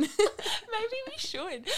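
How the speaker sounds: high, slow, laughing. Real, not acted. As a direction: squeak it out through laughter. Joking after shared laugh.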